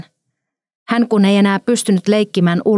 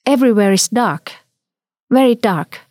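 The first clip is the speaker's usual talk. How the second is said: lightly voiced small child, slightly raised pitch; a hint of a child calling out; childlike exclamation; 3 semitones higher than usual. A narrator's reading. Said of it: "Hän oli huutanut, lapsenomaiseen tapaansa".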